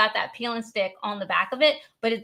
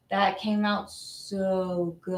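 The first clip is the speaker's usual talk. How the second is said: very slow, lowered and softer marvelling; excited low voice; 3 semitones lower, 5 dB quieter, much slower than usual. Real, not acted. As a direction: stretch out SO in hushed delight. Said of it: "excited when the voice goes down".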